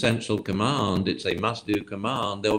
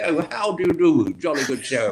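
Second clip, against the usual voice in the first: high, hammed-up English host; mock-genteel jolly welcome; dog as butler; 6 semitones higher than usual. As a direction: greet guests with exaggerated formal cheer. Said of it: "totter up on his hind legs with a silver platter".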